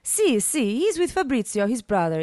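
cheerful Italian host; Italian accent. A narrator's reading. Ivan voiced.

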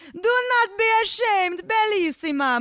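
put-on high, loud, slow accented bellow; booming, drawn-out encouragement. A narrator's reading. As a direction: bellow it like an exuberant Italian lover. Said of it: "Marco bellowed".

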